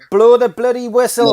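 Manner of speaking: loud, pleading shout at the ref; pleading with the referee. Real, not acted. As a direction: yell at the ref to end it. Begging the ref to blow.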